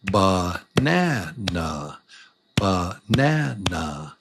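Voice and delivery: "banana" drawn out slowly, beat by beat; syllable by syllable. Shown as a demonstration. Teacher showing every word is a little song, slow measured "banana". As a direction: stretch each syllable of "banana". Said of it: "every word is a little song".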